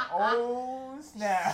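high and loud through laughter; laughing. Real, not acted. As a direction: laugh out the words. Laughing at the spooky smile.

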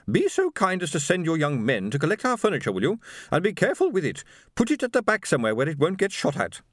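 high-pitched, bossy lady giving orders; imperious wife. A narrator's reading. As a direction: issue instructions like a duchess. Haughty wife gives orders about furniture.

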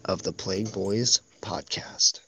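low, unhurried podcast intro voice; ASMR voice. Pretend. Host welcoming listeners to the pilot episode, low ASMR voice. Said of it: "that A S M R voice".

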